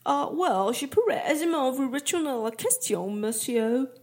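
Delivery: put-on French with a strong English accent. English camper answers the mayor, in English-accented French. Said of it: "au fort accent anglais".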